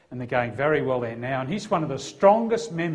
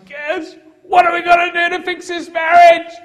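high, full-force screamed demand; much higher and louder, a yelled plea to fix things; imitated scream; 15 dB louder than usual. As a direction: scream it as a desperate, crying wife. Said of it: "She screamed at me, I mean, screamed".

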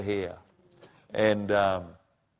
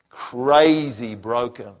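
crazy broken, much louder and very slow; anguished stress; 2 semitones higher, 8 dB louder, much slower than usual. Real, not acted. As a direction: drag out each word as if breaking. Grief over son's death.

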